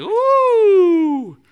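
high, loud wincing ooh; awkward ooh. Retold or imitated. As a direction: wince out loud at the awkward moment. Awkward moment reaction.

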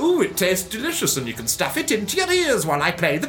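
very high, fast, sing-song and giddy; whimsical Doctor voice. Acted. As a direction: prance through it like a dotty eccentric. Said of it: "this is the whimsical Doctor".

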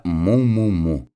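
imitated cow's moo; storyteller's playful moo. A narrator's reading. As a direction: moo it lightly like a cow. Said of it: "и мычала".

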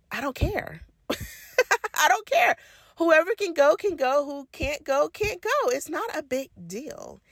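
high-pitched, dismissive shrug, softening at the end; amused indifference. Retold or imitated. Podcaster to parent about who can go, amused indifference.

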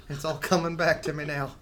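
high, loud, hammed-up Southern quote; mock Southern accent. Host quoting [name] for the joke, mock Southern accent. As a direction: quote the song line in a playful Southern accent. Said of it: "to quote [name]".

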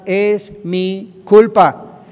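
"Es mi culpa" loud and stretched out slowly; loud slow declaration of guilt; emphatic confession. Shown as a demonstration. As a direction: proclaim "Es mi culpa" with full force. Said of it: "Hay que aprender a confesar".

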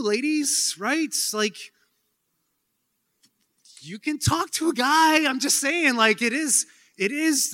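high, loud, mock-exasperated lecture; playful high-pitched scolding of the women; animated teasing. Real, not acted.

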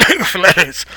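coughing the words out while choking. Pretend. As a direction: choke and cough through the name. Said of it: "Desculpa, enca-, engasguei".

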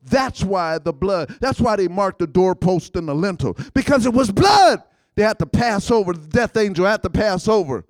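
loud rhythmic pulpit delivery; preaching at full force. Acted. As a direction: hammer each line like a revival preacher.

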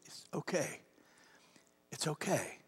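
soft whisper; whispered reassurance; dad's whisper. Retold or imitated. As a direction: hush him gently like a comforting father. Dad comforting son in closet.

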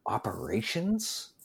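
high, breathless whispered gasp; horrified whisper. Retold or imitated. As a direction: whisper it back in disbelief. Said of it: "they had this look of horror on their face".

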